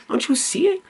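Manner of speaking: exasperated high plea. Real, not acted. Urging viewer, repeated question.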